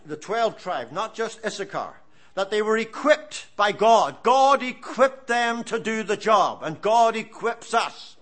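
usual speaking voice

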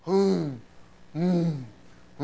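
soft humming of a cow chewing; quiet low hums like a cow at the cud; contented cow hum. Retold or imitated. Preacher imitating cows chewing cud, soft contented hum.

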